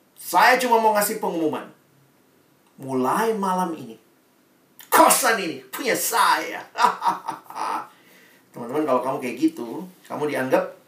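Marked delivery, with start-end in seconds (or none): 0.1-6.6 mock proud announcement. Pengumuman sombong anak kos.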